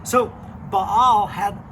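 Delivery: put-on animated storyteller voice for kids; lively Bible story narration. Youth pastor telling kids a Bible story, animated narration. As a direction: tell it like a children's pastor spinning a tale.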